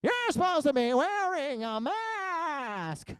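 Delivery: high, drawn-out mocking screech; exaggerated slow, high nagging wail; scolding store lady. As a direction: imitate a scold, stretching every word. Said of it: "That's the way she sounded".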